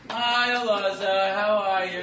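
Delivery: slow, loud group greeting; drawn-out mock chorus hello; support group chorus. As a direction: chant the welcome like a whole meeting. Acts support group greeting in unison.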